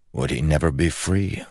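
ordinary delivery, nothing marked

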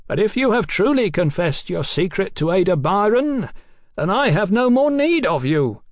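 high, ringing verdict; finality. A narrator's reading. Captain declares the doctor useless to him, final. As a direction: pronounce a sentence from afar. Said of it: "in a voice of finality".

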